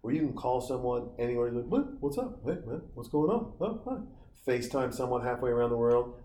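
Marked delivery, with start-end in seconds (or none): 1.7-4.0 squeaky, much higher casual phone voice; mock caller. Mocking spoiled kids who call anywhere for free, high squeaky voice. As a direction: lampoon a spoiled kid chatting on a free call.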